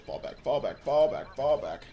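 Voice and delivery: urgent shouted retreat call; frantic retreat order. Real, not acted. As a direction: call the troops back in a hurry.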